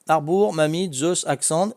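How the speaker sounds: ordinary delivery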